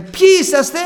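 loud, high call into the night; father calling out. Retold or imitated. Father challenging strangers in the dark, loud call. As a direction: shout out to unseen figures.

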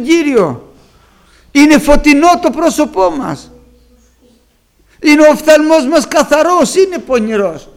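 loud, high sermon voice building with fervor; climbing into full-voiced preaching; fervent preaching. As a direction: preach it out to the congregation, ringing. Preaching rises in force.

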